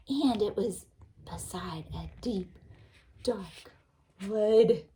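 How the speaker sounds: hushed, then rising on wood; whispered spooky story voice; spooky storytelling. A narrator's reading. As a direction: make the dark wood sound creepy. Teacher reads spooky phrase.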